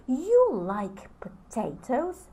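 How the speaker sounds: slow, rising on you, in disbelief; surprised question. Shown as a demonstration. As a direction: ask back as if amazed. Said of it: "I'm acting out".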